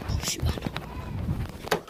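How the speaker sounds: slow hushed whisper. Real, not acted. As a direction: whisper from the closet. Hiding in closet.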